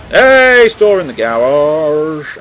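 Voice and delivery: playful loud call. Real, not acted. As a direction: shout at the garage. Calling out to garage.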